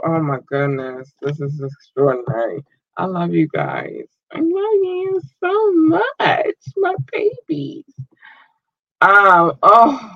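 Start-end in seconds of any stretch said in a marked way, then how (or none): 4.3-7.8 crying cutesy voice. Real, not acted. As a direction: gush it out near tears. Emotional thanks to fans.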